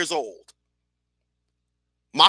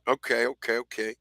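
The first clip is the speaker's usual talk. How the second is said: fading okays; 8 semitones lower, 9 dB quieter than usual. Retold or imitated. Resigned reply to uncle.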